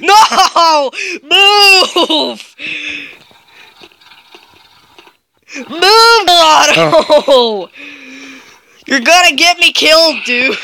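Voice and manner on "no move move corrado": screaming for his friend to move through laughter; half-laughing, half-panicked yell. Real, not acted. Gamer chased by a monster, yelling at his friend in laughing panic.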